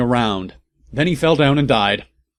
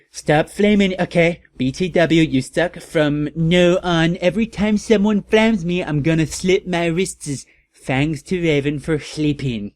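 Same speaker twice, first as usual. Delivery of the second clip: mock snotty teen author; 5 semitones higher than usual. Fic author scolding her flamers, higher bratty mock voice. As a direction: snap at the flamers like a sulky teen. Reads the author's note again.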